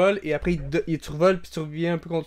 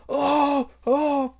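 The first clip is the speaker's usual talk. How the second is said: much higher mock crowd oohs; crowd gasp; 10 semitones higher than usual. Crowd reacting as the champion falls, gasping. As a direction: gasp like a whole arena.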